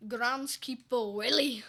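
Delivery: put-on slow, loud, high Scottish accent; Groundskeeper Willie. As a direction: holler it as a Scottish cartoon groundskeeper. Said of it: "I'm also good at accents".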